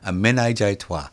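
normal talking voice

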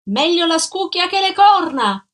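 high, loud taunting shout in a woman's voice; yelling down the stairs. A narrator's reading. Adalgisa from the railing, yelling after Gino. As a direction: hurl it down the stairwell after him. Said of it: "gridò lei affacciandosi alla ringhiera".

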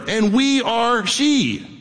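"we are she" drawn out slowly, each word weighted; emphatic. Real, not acted. Preacher declaring to the church who the bride is, emphatic. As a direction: land hard and slow on "we are she". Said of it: "Why do you emphasize that?".

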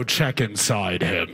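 low, slow ominous order; a hint of a creepy guard behind a door; menacing guard. Guard heard through door.

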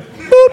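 very high comic boop; squeaky boop. Pretend. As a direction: pop it out like a cartoon. Comic sound effect.

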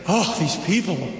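exasperated sigh of a reply. Acted. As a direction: act out weary exasperation with these people. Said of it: "does he sound exasperated?".

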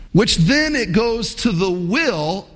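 ordinary delivery

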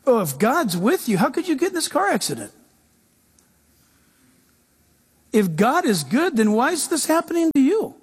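taunting inner voice, rising high; mock voice of doubt, climbing high. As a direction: needle them like a nagging doubt. Preacher voicing doubt's taunts.

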